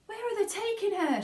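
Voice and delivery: high, shrill panicked mother; a frantic mother shrieking. A narrator's reading. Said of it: "shrieked Missus Salt".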